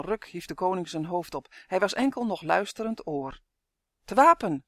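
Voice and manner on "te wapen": loud, higher call to arms; shouted alarm. A narrator's reading. King to guards as battle breaks out, shouted. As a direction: sound the alarm with a short shout. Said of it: "schreeuwde hij".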